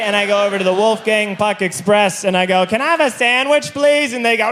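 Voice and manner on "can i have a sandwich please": timid plea. Retold or imitated. Timid ask at food stand.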